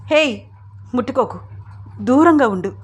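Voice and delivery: mildly acted angry rebuff; angry warning. A narrator's reading. Tearful mother warns Ram not to touch her, angry warning. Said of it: "కళ్ళు తుడుచుకుని కోపంగా అంటూ".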